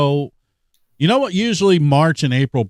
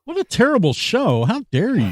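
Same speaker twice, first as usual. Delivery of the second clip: much higher, hammed-up indignation; mock offended; 10 semitones higher than usual. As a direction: act outraged at the insult, tongue in cheek. Mock offence at the show insult.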